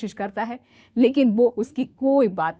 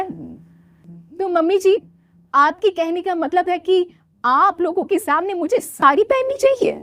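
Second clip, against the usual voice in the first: put-on young bride voice; 8 semitones higher, 4 dB louder than usual. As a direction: play the naive new bride, pitched up. Soumya voiced by narrator. (ऊँची आवाज़ में भोली बहू)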